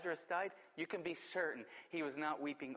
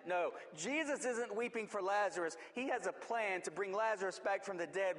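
rising preaching; 3 semitones higher than usual. Acted. Pastor insisting God has the greater plan, fervent preaching. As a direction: build it up with conviction.

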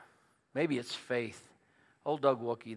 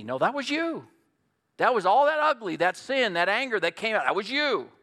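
loud, high, driving sermon delivery; preacher building to a loud climax; full-force preaching; 5 semitones higher, 6 dB louder than usual. Acted. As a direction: hammer every "It's not" home from the pulpit.

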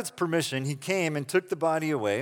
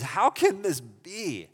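mildly amazed, drawn-out question; slow, understated wonder, acting out Nicodemus; 3 semitones higher, slower than usual. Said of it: "he is amazed multiple times".

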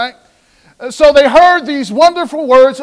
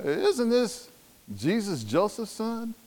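doubting murmur; 16 dB quieter than usual. Retold or imitated. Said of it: "somebody out the crowd said".

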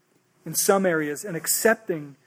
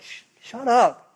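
higher, drawn-out mock shushing; hushing; 4 semitones higher, 4 dB louder, slower than usual. Crowd silencing the blind man who is yelling, hushing. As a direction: shush him sharply, louder on the last order. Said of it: "People are like".

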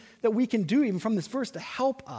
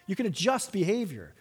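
usual speaking voice

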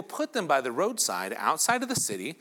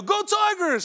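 fan shout; 4 dB louder than usual. Retold or imitated. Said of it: "they go".

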